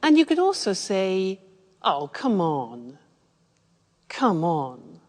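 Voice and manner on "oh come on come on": low, slow scoffing protest; dismissive disagreement. Shown as a demonstration. Teacher demonstrates a blunt way to disagree, drawn-out and dismissive. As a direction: draw it out in low disbelief. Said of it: "if you want to be aggressive".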